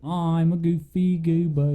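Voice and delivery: a slow, lightly voiced cartoon sing-song; hint of a SpongeBob goof; goofy goober. Quoting a cartoon to his cohost, slow and goofy.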